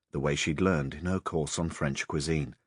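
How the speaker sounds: ordinary delivery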